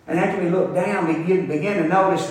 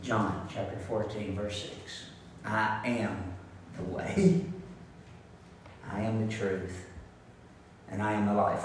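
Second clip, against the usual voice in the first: solemn scripture reading; 11 semitones lower, 14 dB quieter than usual. Acted. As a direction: let each phrase land softly, reverent. Scripture read slowly and softly.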